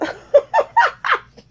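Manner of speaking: high, loud squealing laugh; gleeful shrieking giggle; delighted squeal laugh. Real, not acted. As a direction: burst out in a giddy shriek of laughter. Delighted by favorite color gift.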